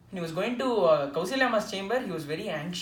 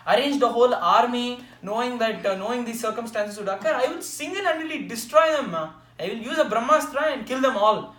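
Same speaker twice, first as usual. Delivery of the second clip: loud, high heroic vow; a younger brother's loud, high battle promise to his mother; heroic fervour; 5 semitones higher, 8 dB louder than usual. Retold or imitated. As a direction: boom it out like a warrior swearing to fight. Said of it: "with all Veera Rasam".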